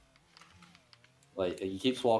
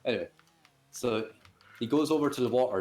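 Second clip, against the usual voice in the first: ordinary delivery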